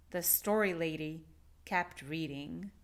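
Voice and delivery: ordinary delivery, nothing marked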